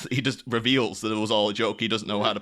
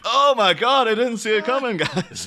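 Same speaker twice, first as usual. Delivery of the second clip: high, loud put-on shock; hammed-up astonished cry; fake surprise; 9 semitones higher, 5 dB louder than usual. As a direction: overact being totally caught off guard. Feigned shock at a spoiled surprise.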